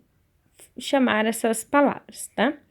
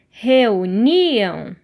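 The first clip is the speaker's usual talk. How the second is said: reuniam stretched very slowly, NI long and loud; stress on NI; 5 dB louder than usual. Shown as a demonstration. Teacher showing pupils the strongest sound, slow stress on NI in reuniam. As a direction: draw out reuniam and swell hard on NI. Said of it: "o som mais forte da palavra reunião".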